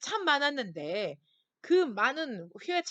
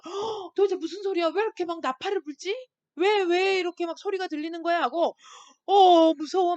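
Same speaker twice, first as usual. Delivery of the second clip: higher, louder alarmed questions; startled, frightened villagers; 6 semitones higher, 8 dB louder than usual. Retold or imitated. Villagers hearing the warning trumpet, alarmed and scared. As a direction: gasp and panic at the trumpet blast. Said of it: "막 이러는 거예요".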